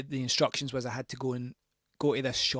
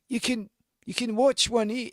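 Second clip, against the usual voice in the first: high, loud, put-on Scottish old man; a clumsy go at an Aberdeen accent on "You can, you can watch one, eh?"; attempted Aberdeen accent; 11 semitones higher, 8 dB louder than usual. Narrator mimics the landlord's Aberdeen accent offering DVDs. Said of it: "I don't know what an Aberdeen accent's like".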